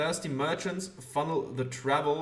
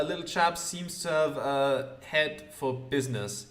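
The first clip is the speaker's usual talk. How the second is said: normal talking voice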